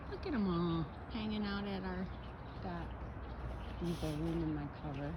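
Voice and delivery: soft, half-whispered morning talk; hushed early-morning voice. Real, not acted. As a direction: keep it hushed so no one wakes.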